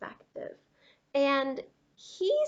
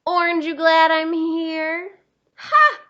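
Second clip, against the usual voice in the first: loud, much higher crow of triumph; gloating joke; 5 semitones higher, 13 dB louder than usual. Acted. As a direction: crow the pun and laugh at the rival. Crayon crowing a pun.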